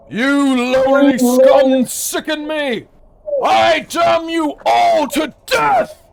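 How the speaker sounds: slow, high, booming condemnation; villainous curse. Acted. Tempest mage damning all the pirates, villainous shout. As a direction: shout each word like a curse. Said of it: "He goes".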